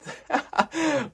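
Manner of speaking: short, high-pitched giggle; a quick high chuckle; amused laugh. Real, not acted. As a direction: laugh lightly at yourself. Amused by own game summary.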